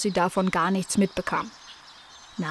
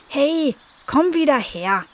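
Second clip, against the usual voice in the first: higher, slow calling out; called out; 6 semitones higher, slower than usual. A narrator's reading. Nala calling after the fleeing kitten. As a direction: call the kitten back. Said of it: "rief Nala Nasebraun".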